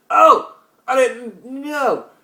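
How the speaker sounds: high loud gasp, drawn out very slowly; sudden realization. Real, not acted. Vlogger suddenly recalling football news, loud and slow. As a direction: blurt it out as it hits you.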